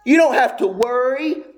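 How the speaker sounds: high, emphatic pulpit exhortation; full-force preaching. Real, not acted.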